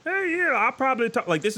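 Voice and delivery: high, quick puppet voice; light, high-pitched character voice; Jasper Rabbit. Shown as a demonstration. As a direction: try out a goofy high rabbit voice. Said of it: "That's how I got the voice of".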